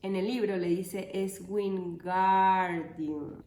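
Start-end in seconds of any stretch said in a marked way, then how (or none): 1.1-3.4 "Wingardium" slowed, with GAR long and loud; correcting with a long, clear GAR in "Wingardium"; stress on GAR. Shown as a demonstration. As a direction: lean hard on GAR in "Wingardium". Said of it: "Pronuncia gar más claro".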